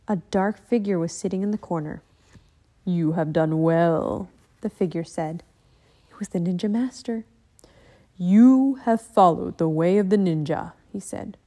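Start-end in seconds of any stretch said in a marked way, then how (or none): 2.8-4.3 a lower, slow, measured master's voice; calm ninja master. Acted. 8.2-10.7 calm ninja master. Acted.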